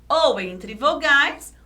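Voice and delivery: ordinary talk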